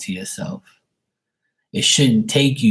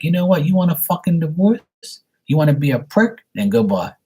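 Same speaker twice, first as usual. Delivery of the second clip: sister fed up; 7 semitones higher than usual. Retold or imitated. Sister telling husband to go, angry and higher. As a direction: snap back like a fed-up wife ending it. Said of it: "And she's like".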